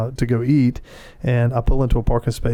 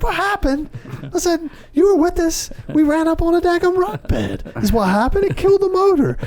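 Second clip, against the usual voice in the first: much higher, exasperated echo of the question; exasperated retort. Retold or imitated. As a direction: fire it back at the son, fed up. Dad's exasperated retort to son.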